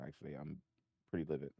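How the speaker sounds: ordinary talk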